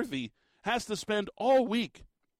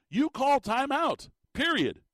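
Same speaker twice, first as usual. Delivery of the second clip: firm insistence, louder and higher; emphatic; 8 semitones higher, 3 dB louder than usual. Real, not acted. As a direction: call punched high and loud, slowed. Host insisting on obvious call.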